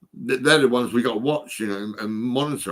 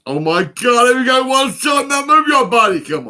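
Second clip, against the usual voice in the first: much higher, much louder hyped shout; rave MC shout; 10 dB louder than usual. Pretend. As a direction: yell it out like an MC firing up a nineties rave. Bursts into rave MC chant.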